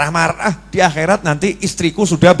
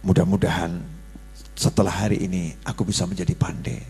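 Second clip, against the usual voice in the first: low, soft meek answer; humble reply; 13 semitones lower, 11 dB quieter than usual. Acted. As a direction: say it meekly, quietly.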